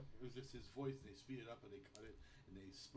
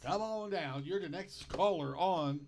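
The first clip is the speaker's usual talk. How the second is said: game-show style call-in; 3 semitones higher, 12 dB louder than usual. Acted. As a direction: call the next contestant down, loud and big. Hyped retake of the call-in intro.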